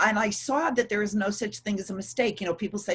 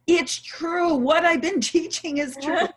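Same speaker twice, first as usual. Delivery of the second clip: high, louder excited exclamation; excited realization; 8 semitones higher, 4 dB louder than usual. Retold or imitated. Teacher retelling her near-death revelation, excited realization. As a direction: marvel out loud, pitch lifted. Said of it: "I'm sitting here thinking, ... I was like".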